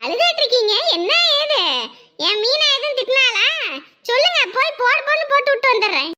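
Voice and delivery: high-pitched, protective fussing; indignant concern. Acted. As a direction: press her to tell who upset her. Visitor demands who upset the mother.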